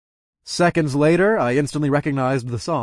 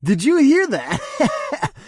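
high, slow sneering voice; mocking; 6 dB louder, much slower than usual. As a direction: sneer it out slowly. Toby mocks Carter.

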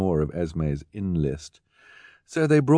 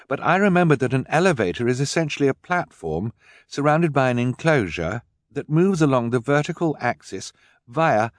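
put-on higher, keen girl explaining; young girl Violet; 6 semitones higher, 4 dB louder than usual. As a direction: explain eagerly, inventor-like.

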